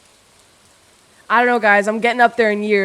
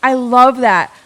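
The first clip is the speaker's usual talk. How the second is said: ordinary talk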